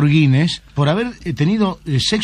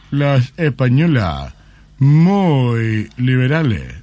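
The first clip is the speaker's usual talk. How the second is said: very slow, savouring verdict; pause, then drag out the praise; slow Japanese man; 5 semitones lower, much slower than usual. Acted. Japanese lover judging women for the interviewer, very slow.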